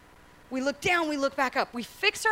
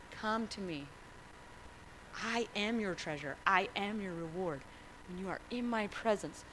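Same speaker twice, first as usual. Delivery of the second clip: gentle voice of Jesus; 4 semitones lower, 12 dB quieter than usual. Acted. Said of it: "He says".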